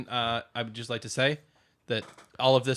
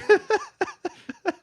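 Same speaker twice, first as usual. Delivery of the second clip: very high squealing laugh. Real, not acted.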